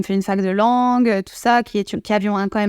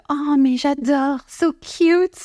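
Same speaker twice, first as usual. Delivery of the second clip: high, swooning mock delight; gushing local; 4 semitones higher, much slower than usual. Imitating locals swooning over a French accent, high. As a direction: gush like a charmed fan. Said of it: "ils sont en mode".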